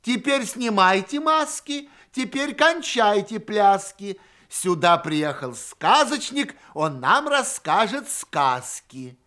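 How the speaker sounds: high, loud call across a hall; party host calling out, pitched high; calling from afar. A narrator's reading. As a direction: shout instructions to far-off children, high and loud. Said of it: "кричит издалека".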